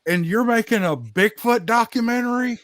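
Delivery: pressing the point, baffled; incredulous. Real, not acted. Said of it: "continuing his incredulous rant".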